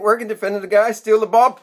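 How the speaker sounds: normal talking voice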